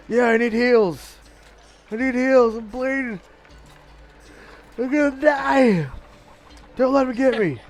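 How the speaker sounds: panicked pleading. Acted. Wounded character begging for healing.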